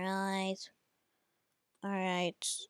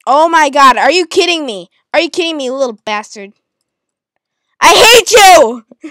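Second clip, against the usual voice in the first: yelled much louder, pitch climbing; furious yelling; 4 semitones higher, 14 dB louder than usual. Real, not acted. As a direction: shout your hate at him. Raging at enemies in game.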